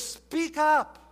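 loud, high preacher's command; booming apostle's charge; Paul's charge, voiced loud. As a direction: call it out like Paul charging Timothy. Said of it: "He says".

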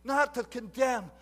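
full-force sermon delivery; fiery preaching. Acted. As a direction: preach it to the back row.

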